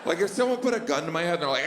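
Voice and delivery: ordinary talk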